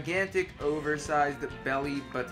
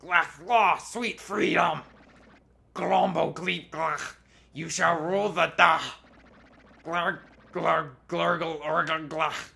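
monster gibberish; 2 semitones higher, 4 dB louder, slower than usual. Pretend.

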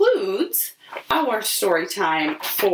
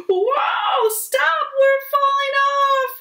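very high squealing shout; very high panicked squeal; 4 dB louder, slower than usual. Acted. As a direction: squeal it like tumbling animals. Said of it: "squeal the animals".

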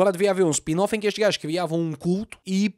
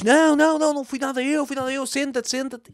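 panicky denial; 7 semitones higher than usual. Retold or imitated. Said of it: "E o gajo diz".

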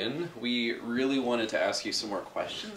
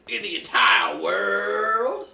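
slow, loud, much higher rising yell; drawn-out shout; 7 semitones higher, 8 dB louder, slower than usual. Acted. Interviewer finishing the grilling at full volume, outraged. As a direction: stretch it out like a showdown.

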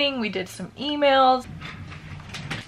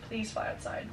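whispered plea; 14 dB quieter than usual. Real, not acted. Begging bee to leave.

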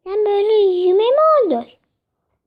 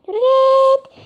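loud, higher shoo; 5 semitones higher, 5 dB louder than usual. Acted. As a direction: chase it away. Shooing away, louder. (yüksek sesle kovalıyor)

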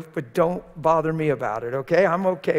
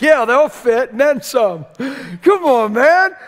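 high, loud, playful; loud teasing shout; 9 semitones higher, 11 dB louder, slower than usual. Retold or imitated. Preacher loudly teasing Billy about shoe size. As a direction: tease him at full volume. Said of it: "I said".

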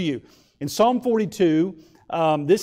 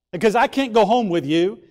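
ordinary talk, nothing marked

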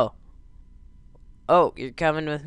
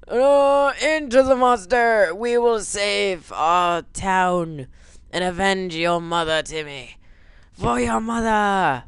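heroic dad voice; 5 semitones higher, 5 dB louder, slower than usual. Pretend. Heroic dad rallying son.

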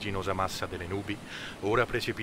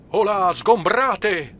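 slow, booming shout, much higher and louder; captain ordering Kammamuri below, drawn-out roar; thundering order; 12 semitones higher, 12 dB louder, much slower than usual. A narrator's reading. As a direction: thunder it at the man who won't leave. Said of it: "tuonò il capitano".

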